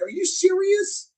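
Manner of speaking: squeaky high incredulous squeal; high mock outrage at a TV chef; mock disbelief. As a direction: play up the disbelief, pitch leaping up. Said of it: "I'm like".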